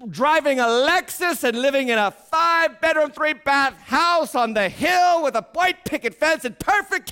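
loud, high sermon shouting; fiery preaching. Real, not acted. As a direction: preach it hard to the congregation. Preaching at full force.

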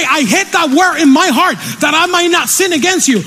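high-pitched, rousing sermon delivery; impassioned preaching. Acted. Preacher urging himself and congregation onward, impassioned. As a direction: preach it with rising fervor.

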